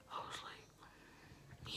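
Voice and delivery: barely audible. Real, not acted.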